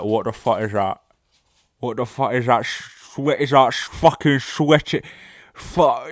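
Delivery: garbled mock whale voice, increasingly repulsed; disgusted whale. Whale that sucked up something, mock disgust, spluttering. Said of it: "It was like".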